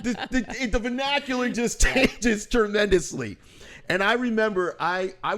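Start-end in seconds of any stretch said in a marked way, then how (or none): 0.0-3.4 laughing, loud and high street talk; loose home voice. Retold or imitated. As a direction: let it all hang out, cracking up. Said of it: "He goes home".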